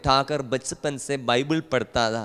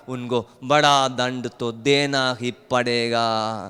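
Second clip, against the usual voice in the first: ordinary talk, nothing marked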